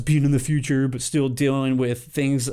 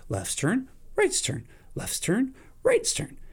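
sing-song turns; 8 semitones higher, 3 dB quieter than usual. Retold or imitated. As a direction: swing the pitch up and down each turn. Sing-song alternating merge.

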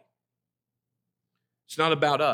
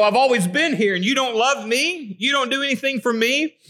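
whiny entitled complaint; 9 semitones higher than usual. Retold or imitated. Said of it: "these people that go".

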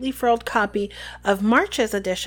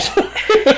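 short high burst of laughter; quick high-pitched laugh; 11 semitones higher, 6 dB louder than usual. Real, not acted. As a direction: break into a loud laugh. Laughs with the co-host.

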